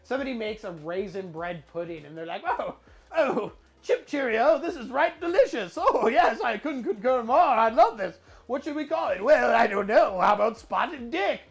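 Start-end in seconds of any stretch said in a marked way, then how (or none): 2.5-11.4 hammed-up British accent, jolly and lively; over-the-top comic British chatter, laughing; generic UK accent. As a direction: imitate delighted Brits with mock UK accent. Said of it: "generic U K accent".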